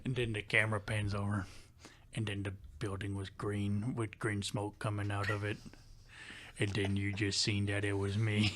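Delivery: low, imitated country voice; deep country coworker. Imitating the coworker finishing his scenario, deep country voice. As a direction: narrate it deep in a country accent. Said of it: "he had a real deep down low voice".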